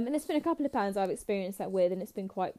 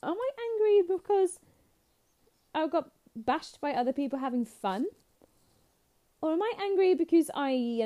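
high, exaggerated puzzling aloud; re-enacted hangry soul-searching; mock angry self-questioning; 7 semitones higher than usual. Hangry fan questioning herself at night, mock frustration. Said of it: "I just was really angry".

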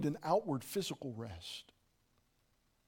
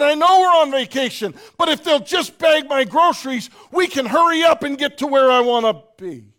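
loud, higher, insistent I know; mock loud protest; 9 semitones higher, 14 dB louder than usual. Preacher retelling his reply to his wife, loudly. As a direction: push back hard and impatient. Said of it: "I said".

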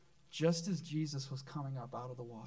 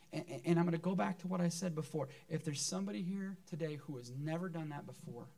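usual speaking voice

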